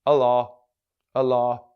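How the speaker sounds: loud but unconvinced "Allah, Allah"; half-hearted. Retold or imitated. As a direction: toss off "Allah" without meaning it. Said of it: "No, you need to act".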